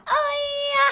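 long high, loud squeal; flustered squeal. Real, not acted. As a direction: shriek from embarrassment. Squeals at romantic line.

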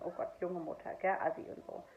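soft, sneering imitation of a judging woman; mock disdain. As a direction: mimic the looks she gave, quietly. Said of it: "so von wegen". (leise, abfälliges Nachäffen)

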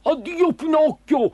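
high, drawn-out farewell in a put-on dog voice; grateful dog. Dog bidding Pinocchio farewell, high and grateful. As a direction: call out a big farewell as the dog.

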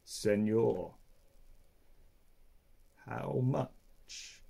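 very slow, soft, long-paused sloth voice; deep lazy sloth. Acted. Three-toed sloth asking the sleeping man slowly, deep and lazy. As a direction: drag each word out lazily. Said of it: "her deep and lazy voice".

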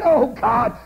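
loud, high, desperate prayer; praying aloud in fervent earnest; shouted prayer. Acted. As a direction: cry out to God from your knees. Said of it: "He drops beside the bed and said".

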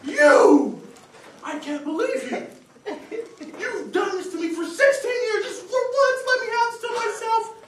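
loud, high, anguished scolding; hammed-up tirade. Man berating his roach in the bathroom, melodramatic fury. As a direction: rage and plead as if betrayed.

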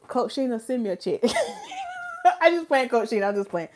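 usual speaking voice

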